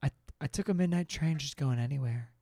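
a hint of a far, dreamy narrator; lightly hammed lyric recital; mock sincere. Parodying Don't Stop Believin lyrics.

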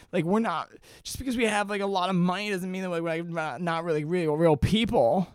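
high-pitched mock upset rant; imitated freaking out. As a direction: mimic her flustered indignation, stumbling along. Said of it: "She, like, is freaking out".

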